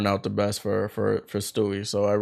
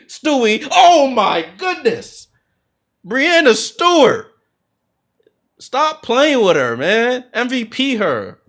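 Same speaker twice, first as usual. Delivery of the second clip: higher, louder, slow amazed cry; excited outburst; 14 semitones higher, 9 dB louder, much slower than usual. Real, not acted. Commentator losing it over Stewie's threes, high excited cry. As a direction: marvel out loud at the shot.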